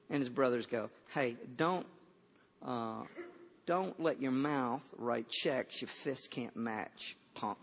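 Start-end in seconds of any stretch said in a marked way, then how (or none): 1.1-7.6 low, quiet, slow threat; mock menace, kept low and soft; deadpan tough-guy warning. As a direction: put him down coolly, as a big brother. Brothers' tough put-down, deadpan.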